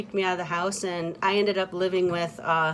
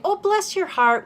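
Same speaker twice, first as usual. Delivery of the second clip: high sugary "Oh, bless your heart"; therapist's sweet drawl; 10 semitones higher than usual. Retold or imitated. Therapist soothing a client, mock sweet Southern voice. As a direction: coo it sweetly like a Southern lady, much higher. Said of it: "she used to say".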